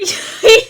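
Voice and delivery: short high laugh bursting out; burst of laughter. Real, not acted. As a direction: laugh out loud at the memory. Laughing at own story.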